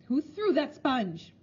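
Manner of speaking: a slow, looming school matron's challenge; dreaded matron's call. A narrator's reading. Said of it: "the dreaded voice called out".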